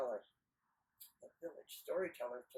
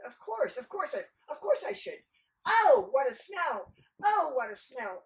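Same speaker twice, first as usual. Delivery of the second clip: high, excitable simpleton; hammed-up eager exclaiming; eager agreement; 8 semitones higher, 9 dB louder than usual. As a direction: seize on the advice, high and loud. Nasrudin eagerly takes up the phrase.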